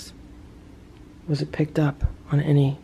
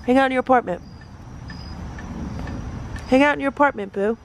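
higher sing-song coaxing; 6 semitones higher, faster than usual. Real, not acted. Telling cat Boo to hang out in his apartment, high coaxing baby talk. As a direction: coax the cat to stay in its shelter.

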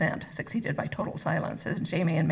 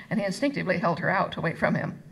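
usual speaking voice

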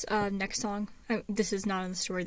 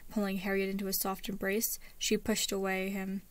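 usual speaking voice

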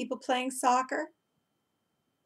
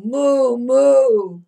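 loud, drawn-out moo; mooing cow; 3 semitones higher, 10 dB louder than usual. Shown as a demonstration. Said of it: "Let me hear you all go".

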